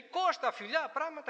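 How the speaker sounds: wealthy stranger hailing Kostas in the square, high and excited; effusive greeting. Retold or imitated. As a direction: greet him like a long-lost brother.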